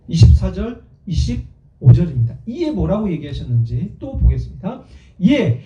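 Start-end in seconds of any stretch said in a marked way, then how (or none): 5.2-5.6 loud, measured pulpit reading; declamatory Bible recitation; scripture reading. Acted. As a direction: read the verse out with force. Reads scripture to congregation.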